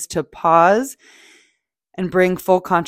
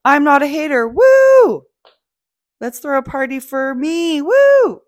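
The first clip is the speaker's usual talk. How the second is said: sudden high, loud whoop of fake celebration; mock party cheer; 8 semitones higher, 7 dB louder than usual. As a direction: cheer for yourself like it's a party.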